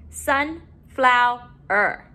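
"Sunflower" slowly, in three beats; syllable by syllable. Shown as a demonstration. Teacher modeling syllables to put together, broken up. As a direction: split "Sunflower" with pauses between syllables. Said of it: "let's put our syllables together".

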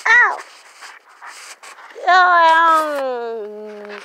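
sharp pained yelp; cry of pain. Real, not acted. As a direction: yelp as the door catches you. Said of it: "I got my jacket stuck in the door".

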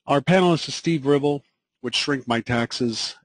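ordinary talk

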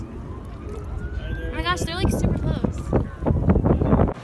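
startled squeal. Real, not acted. Startled by geese nearby.

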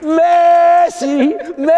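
long, high, loud drawn out call of the name; commentator's cry. Retold or imitated. Welcoming Messi at his presentation, a loud drawn-out cry. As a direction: belt the name like a goal call. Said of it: "Et comment faire ça?".

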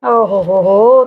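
low scornful "ਓ ਹੋ ਹੋ ਹੋ"; mocking scoff. Sister-in-law mocks Meet in the quarrel, low scornful scoff. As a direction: scoff at her claim to be wise.

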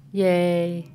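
ordinary talk, nothing marked

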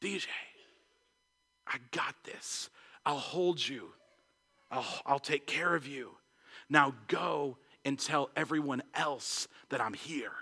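hushed, low, from whisper to soft voice; very soft, unhurried comfort with pauses; God's quiet assurance. Retold or imitated. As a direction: reassure tenderly as a voice in the heart. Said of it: "this voice that just says".

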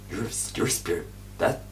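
ordinary talk

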